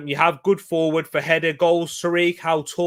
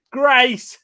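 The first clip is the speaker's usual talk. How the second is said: higher, louder drawn-out name, only teasing; mock exasperation; 10 semitones higher, 7 dB louder than usual. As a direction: call the name out in mock dismay. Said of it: "Joking. I'm only messing".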